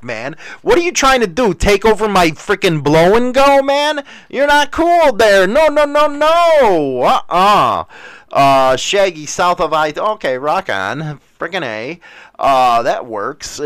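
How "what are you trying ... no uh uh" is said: high-pitched playful indignation; mock indignant protest. Host defending his blow and go, mock indignant. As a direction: protest in a raised voice, climbing higher.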